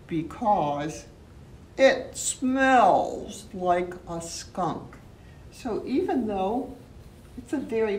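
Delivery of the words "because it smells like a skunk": slow emphatic. Acted. Teacher stressing punchline.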